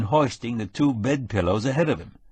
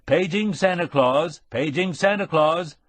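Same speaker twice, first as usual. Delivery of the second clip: PA announcement; 7 semitones higher than usual. A narrator's reading. Public address system page.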